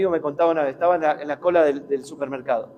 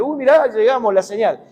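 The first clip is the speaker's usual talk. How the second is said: higher-pitched mock delight at arriving; mock excitement; 5 semitones higher, 3 dB louder than usual. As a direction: act out a silly, excited arrival. Mock arrival celebrated at a signpost.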